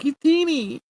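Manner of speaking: high squeaky teasing voice; mocking taunt. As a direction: tease in a tiny high pipsqueak voice. Mocking taunt by other He-Mans.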